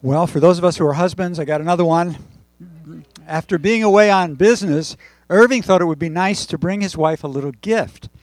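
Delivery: normal talking voice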